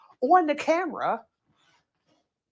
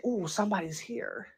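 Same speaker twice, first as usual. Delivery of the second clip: a hushed, offhand "somebody's here"; murmured softly to herself; quiet murmur; 2 semitones lower, 11 dB quieter than usual. Retold or imitated. Herself in bed, noting a visitor with a soft murmur.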